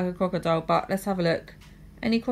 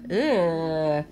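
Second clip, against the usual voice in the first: plain reading voice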